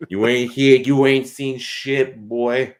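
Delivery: slow Boston brag that ends up shouting; Boston tough guy. Pretend. Mocking a Boston sports fan for a cohost, Boston accent rising to a yell. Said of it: "Maybe slightly Boston?".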